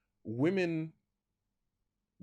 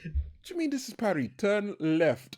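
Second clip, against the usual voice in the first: high, quick indignant comeback; a driver taking the bait from a sarcastic sign; mock outraged driver; 7 semitones higher than usual. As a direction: argue back at the sign. Arguing back at the sign.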